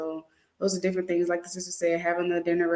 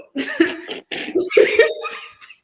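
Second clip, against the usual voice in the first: breathy laugh; 4 dB louder than usual. Real, not acted. Laughing at own joke.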